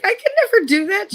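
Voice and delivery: very high, loud mock squeal; horrified protest. Child shown how to kill a rabbit, high horrified refusal. Retold horror at killing a rabbit.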